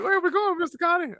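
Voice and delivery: put-on high, childlike pitch; kid voice. A boy asking Connie where they are going, in a high childlike voice. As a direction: pipe up like a small boy.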